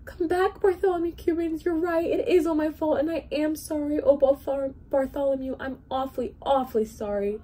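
put-on high, crying old king begging forgiveness; tearful plea. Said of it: "The old king was crying".

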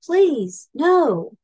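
high, loud pleading; begging. A narrator's reading. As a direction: plead desperately, stretching the words. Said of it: "begged Snail".

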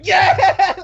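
a high, loud cheer of joy; excited yes. Retold or imitated. Retelling winning the ticket, a high excited cheer. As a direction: cheer like you finally won. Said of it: "I'm like".

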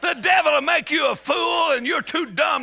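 high, loud rousing preaching; preaching at full force. Real, not acted. Preacher rousing the congregation, loud and high. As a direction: drive it home to the crowd.

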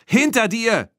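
high, loud cry of alarm; shouted warning. A narrator's reading. Tina to Erik as the bull closes in, shouted warning. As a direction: shout urgently across a distance. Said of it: "rief Tina abermals".

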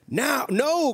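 alarmed cry. A narrator's reading. Witch fleeing the hungry dragon, alarmed cry. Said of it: "cried the witch".

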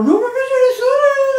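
high, slow, drawn-out no's; mock his stubborn denial; teasing parody of a son saying no; imitating son's denial. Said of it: "ma se ogni volta che te ne parlo".

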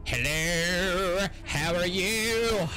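high, drawn-out put-on hello; playful monster greeting. Monster greeting its victims, playful put-on voice. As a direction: stretch it out in a high silly voice.

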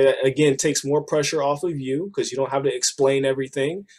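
normal talking voice

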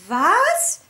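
high, loud startled cry; loud high astonished cry; surprised shout. A narrator's reading. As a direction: blurt out in disbelief. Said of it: "riefen Mona und Frieda".

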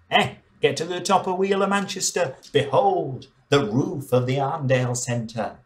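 mock tour guide. Mock tour guide at wheel top.

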